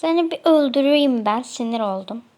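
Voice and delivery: usual speaking voice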